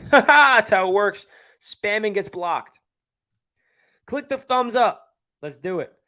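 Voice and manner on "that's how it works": laughing. Real, not acted. Laughing after reading spam comment.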